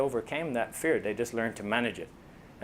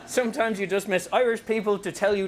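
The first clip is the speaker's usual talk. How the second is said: ordinary delivery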